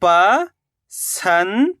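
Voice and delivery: drawn out very slowly, sound by sound; slowly stretched syllable. Shown as a demonstration. Teacher to pupils, sounding out a word very slowly. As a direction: stretch each sound for the class. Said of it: "तो मिलके बन जाएगा पसंद".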